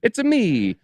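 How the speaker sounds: high, bouncy mock Italian plumber voice; Mario Italian accent. Jokes about being Italian, hammed-up Italian accent. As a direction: ham up a cartoon Italian accent. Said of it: "I could do that accent".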